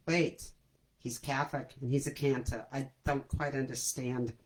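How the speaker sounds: low, soft New York relative voice; Harvey Fierstein. Retold or imitated. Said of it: "start to sound like Harvey Fierstein".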